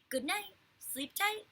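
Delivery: high, slow mock bird voice answering; parrot's reply. A narrator's reading. Fudge faking the parrot's answer, high bird voice. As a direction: echo it back like a parrot. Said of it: "came the reply".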